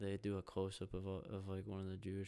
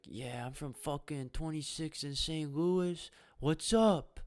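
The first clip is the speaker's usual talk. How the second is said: loud, higher, slow hype-man voice; hyped-up senator; 7 semitones higher, 10 dB louder, slower than usual. Retold or imitated. Imitating senator at graduation.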